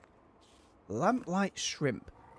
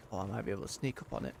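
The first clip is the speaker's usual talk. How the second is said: hushed sneaking; 6 semitones lower, 13 dB quieter than usual. Real, not acted. Sneaking up on monster.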